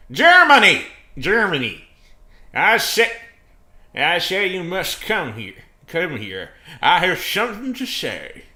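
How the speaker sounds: hammed-up high shout; loud voice. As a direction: burst in dramatically, booming out each phrase. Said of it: "he said in a loud voice as usual".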